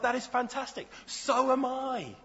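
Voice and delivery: eager passerby. Acted. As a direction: sound delighted to agree. Joke passerby answers each reply.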